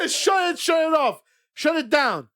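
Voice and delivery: high, loud shouted order with laughter; mock shout. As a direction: yell at the scammer to shut it down. Yelling back at phone scammer.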